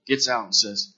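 ordinary delivery

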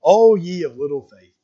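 slow, solemn voice of Jesus gently rebuking; a calm, unhurried rebuke as Jesus; Jesus speaking. Acted. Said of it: "And Jesus utters these words".

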